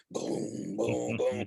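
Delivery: low booming bass noise; imitated car bass. Grandpa describing grandson's bumping car, mimicked bass booms. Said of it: "pulling up the house and going".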